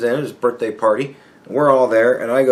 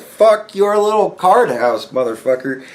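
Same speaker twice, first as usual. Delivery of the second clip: grandma's blunt jab; 6 semitones higher than usual. Retold or imitated. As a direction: spit it out like a cranky grandma. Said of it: "she just went".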